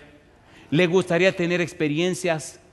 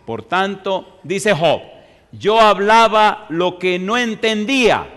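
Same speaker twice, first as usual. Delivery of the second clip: slow, loud, higher Bible reading; slow declaiming of scripture; solemn scripture reading; 4 semitones higher, 5 dB louder, much slower than usual. Acted. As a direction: proclaim Job's words slowly from the pulpit.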